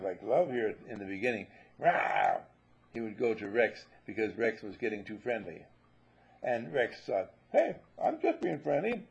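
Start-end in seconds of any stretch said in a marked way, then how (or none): 1.8-2.4 low growling bark; a quick low mock snarl; mock dog growl. Dog Love growls at puppy. 7.5-9.1 higher, lightly voiced puppy protesting; a hint of a hurt, friendly pup; puppy insisting he means no harm; innocent puppy. Puppy Rex voiced in story.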